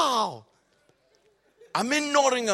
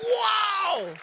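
amazed wow; 12 semitones higher, 3 dB louder than usual. Real, not acted.

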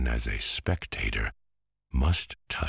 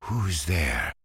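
a slightly higher, trembling call into the dark; quavering voice; 5 semitones higher than usual. A narrator's reading. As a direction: ask shakily, trying to sound brave. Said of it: "in a quavering voice".

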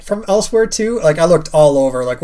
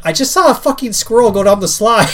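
amused wife; 7 semitones higher, 5 dB louder than usual. Retold or imitated. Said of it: "And she said".

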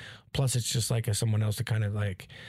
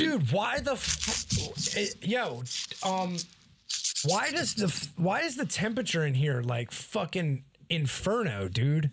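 exasperated; 6 semitones higher, slower than usual. Real, not acted. Complaining about hot room.

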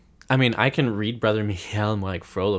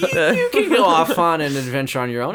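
mock Nana voice; 8 semitones higher, 6 dB louder than usual. Mocking Nana's quest send-off.

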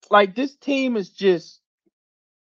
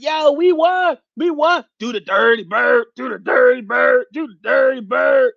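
mock fan cheering; 5 semitones higher than usual. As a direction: shout it like a crowing fan after a win. Gloating Falcons fans on Twitter.

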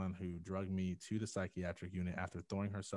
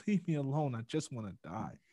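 high, louder mock yell, tapering off; retold scream; 5 semitones higher, 4 dB louder than usual. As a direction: imitate screaming to be left alone. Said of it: "I just kept screaming".

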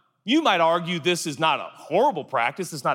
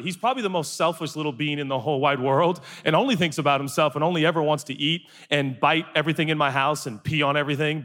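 ordinary talk, nothing marked

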